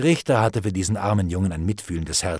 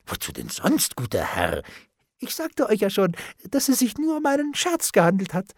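forced nonchalance; 10 semitones higher than usual. A narrator's reading. Bailiff insists it was a joke, high and too casual. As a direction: climb to a high, protesting pitch.

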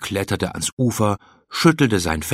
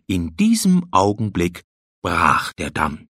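ordinary delivery

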